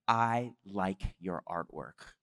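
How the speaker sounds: low, slow, weighty retold voice; God's words. God speaks through Jeff to a stranger, low and slow. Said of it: "God himself speaks to a total stranger".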